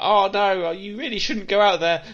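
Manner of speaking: high, loud, mocking cautious victim voice; mock horror warning. Film critic mocking a horror cliché, high and loud. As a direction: parody the nervous friend warning them off.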